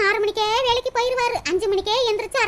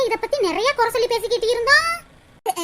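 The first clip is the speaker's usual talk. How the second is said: normal talking voice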